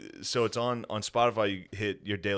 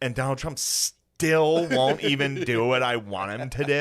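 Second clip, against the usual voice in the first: hammed-up snippy radio host, louder and slower; mock-indignant news lady, loud and drawn out; snippy newsreader; 4 semitones higher, 7 dB louder than usual. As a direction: exaggerate the attitude, stretching words out with raised pitch. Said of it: "Little fucking snippy out of the N P R lady".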